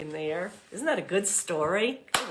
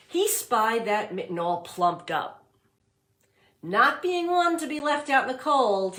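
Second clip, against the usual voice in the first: put-on read-aloud voice for young children; storybook reading; 2 semitones higher, 3 dB louder than usual. A narrator's reading. Teacher reading The Mitten to class, storybook voice. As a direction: narrate it warmly for the class.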